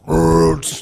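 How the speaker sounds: loud imitated rasping fart; guttural fart noise. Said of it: "guttural fucking, like".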